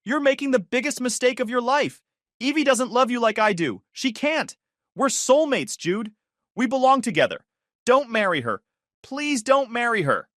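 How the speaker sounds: very high, desperate shriek. Acted. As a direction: shriek and beg, voice breaking. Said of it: "Lauren shrieked, voice cracking".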